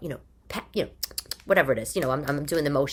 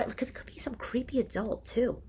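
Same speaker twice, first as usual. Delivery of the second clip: softer, whispered aside; 6 semitones higher, 7 dB quieter than usual. Real, not acted. Host whispering a worried aside about creepy adults online. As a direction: let it slip out as a secret aside.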